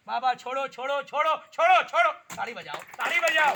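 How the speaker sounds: calling out. Real, not acted. Commanding the trick, calling out.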